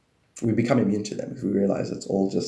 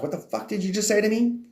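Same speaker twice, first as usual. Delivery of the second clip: mock baffled universe; 10 semitones higher than usual. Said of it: "The universe is like".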